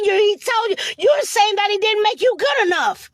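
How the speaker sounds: normal talking voice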